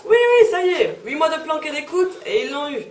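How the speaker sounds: excited. Acted. Excited news-style recap.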